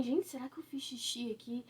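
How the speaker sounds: hushed, worried thinking aloud; half-voiced as if to herself; a soft, puzzled question to herself; quiet inner thought. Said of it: "Eu pensei assim".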